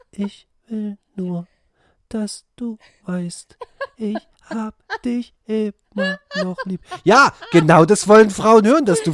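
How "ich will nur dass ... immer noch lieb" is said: right in your ear, fragile and slow; fragile whisper-close singer voice. Retold or imitated. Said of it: "diese ganz leicht zerbrechliche Stimme".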